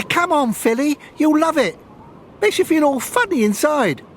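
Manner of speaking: put-on high girl's voice, lively; young girl voice. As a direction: voice a young schoolgirl. Girls' dialogue voiced high.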